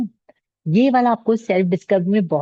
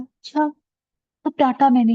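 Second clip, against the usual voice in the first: higher-pitched, taken-aback questioning; retold shock; 6 semitones higher, 3 dB louder than usual. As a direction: imitate being caught off guard by an accusation. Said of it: "I was so shocked".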